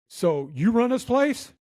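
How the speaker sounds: high, drawn-out challenge; retold irate Marine sizing up the manager; mad drill sergeant. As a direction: imitate an angry customer's slow demand. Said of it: "he was mad".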